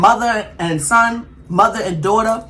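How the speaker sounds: slow, deliberate lecture; slow and emphatic. Real, not acted. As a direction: spell it out slowly. Slow emphatic point about trust.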